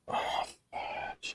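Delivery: whispered mild curse. Real, not acted. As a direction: breathe it out under your breath.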